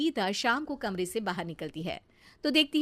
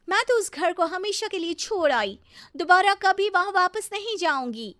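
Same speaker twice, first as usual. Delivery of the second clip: high-pitched, indignant vow; huffy declaration; 10 semitones higher, 5 dB louder than usual. Acted. Sister-in-law arrives vowing never to go back, indignant. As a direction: declare it over, still cross. Declares she left for good.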